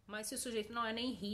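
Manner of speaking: ordinary talk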